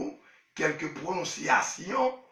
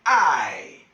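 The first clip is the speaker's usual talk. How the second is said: I said louder and lower; 6 semitones lower, 7 dB louder than usual. Real, not acted. Wife repeats the letter I loudly. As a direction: say the letter I out loud. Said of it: "I Love English School".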